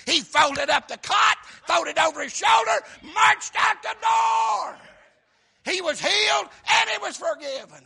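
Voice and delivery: loud, high, shouted preaching; preaching at full force. Acted. Preacher shouting the healing story to the congregation, full force.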